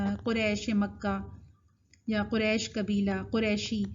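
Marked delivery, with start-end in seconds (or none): 0.2-1.2 قریش Mecca with a flat "e", qureshe; everyday Urdu reading. Shown as a demonstration. Teacher citing familiar usage, flattened vowel. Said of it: "ہم کہتے ہیں نا". 2.2-3.8 قریش with a single "e" vowel; everyday Urdu reading. Shown as a demonstration. Teacher citing familiar usage, flattened vowel. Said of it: "ہم کہتے ہیں نا".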